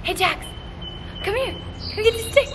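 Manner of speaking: very high coaxing call; calling a puppy. Real, not acted. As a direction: coax the puppy over gently.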